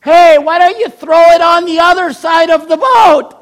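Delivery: loud high call to the boat; shouted advice. Retold or imitated. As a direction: yell it out across the water. Stranger shouting advice from the beach.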